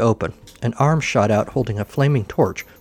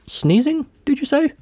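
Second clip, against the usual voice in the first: incredulous. Acted. Incredulous doctor.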